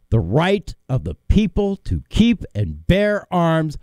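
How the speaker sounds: slow, deliberate read-out. Shown as a demonstration. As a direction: read it slowly, phrase by phrase with pauses. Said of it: "I'm going to read it slow".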